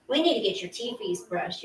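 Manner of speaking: ordinary talk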